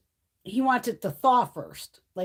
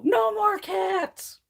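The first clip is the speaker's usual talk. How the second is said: high, slow shouted protest; drawn-out mock yell; mock husband's shout; 10 semitones higher, much slower than usual. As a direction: bellow it like a fed-up husband. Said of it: "Greg loves to always go".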